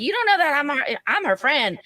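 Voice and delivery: high, indignant retort; shrill drunk comeback. Retold or imitated. Drunk customer defending herself, high and indignant. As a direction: snap back at the bartender, voice way up.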